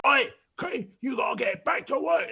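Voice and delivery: high, loud shout across a room; bellowing at a worker from afar; background shout. Retold or imitated. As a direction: yell like a boss calling from the back.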